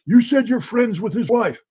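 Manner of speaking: high, pushy accusation; accusing interrogator. Retold or imitated. Agent accusing Marina about spy's wife, high accusation. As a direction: throw it at her.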